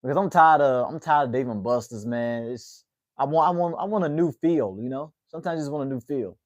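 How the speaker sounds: usual speaking voice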